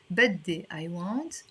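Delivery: ordinary talk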